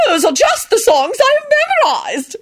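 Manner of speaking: very high, thrilled squeal; high-pitched gushing excitement; excited shriek. A narrator's reading. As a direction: squeal it with delight. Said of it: "Caroline shrieked excitedly".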